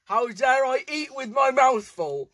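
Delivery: theatrical mock protest; mock outrage. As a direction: ham up the offence. Mock outrage versus quiet repeat.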